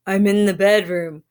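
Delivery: strange quavering fake granny; wolf faking a shaky old voice; wobbly voice. A narrator's reading. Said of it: "in a strange, wobbly voice".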